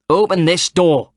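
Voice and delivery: loud, slow angry shout. A narrator's reading. Woman outside demanding the door be opened, shouting. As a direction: bang on the door and yell it. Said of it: "a woman shouted".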